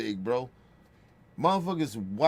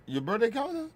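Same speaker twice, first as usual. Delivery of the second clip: surprised question; 10 semitones higher than usual. Retold or imitated. Surprised reply to aunt.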